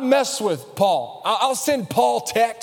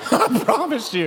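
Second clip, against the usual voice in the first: laughing; 4 semitones higher than usual. Real, not acted. Laughs at his own story.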